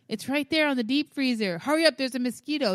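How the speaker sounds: retold anxious urging; urgent hurrying. Urgently hurrying son out of garage.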